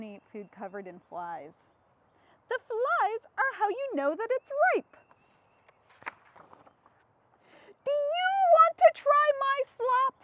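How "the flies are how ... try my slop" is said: higher, sweet eager little pig; Piggy voice. Acted. Piggy inviting Gerald to taste her slop, high eager voice.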